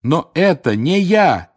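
slow, high, emphatic denial; desperately earnest protest; fervent. A narrator's reading. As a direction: insist loudly, stretching each word. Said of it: "истово произнёс Гарри".

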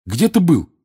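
loud, high, urgent question; demanding. Acted. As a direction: burst in demanding an answer. Hero bursts in, demanding an answer.

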